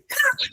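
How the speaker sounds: quick burst of laughter; bright little laugh; short high laugh. Real, not acted. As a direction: laugh at your own joke. Laughing after mock fight.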